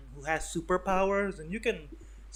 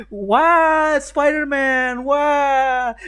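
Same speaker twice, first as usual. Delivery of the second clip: much higher, louder, drawn-out disbelief; mock baffled crowd; 11 semitones higher, 14 dB louder, much slower than usual. Onlookers seeing the fake Spider-Man, mock baffled shout. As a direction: stretch it out in total confusion.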